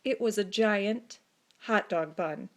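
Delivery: ordinary delivery, nothing marked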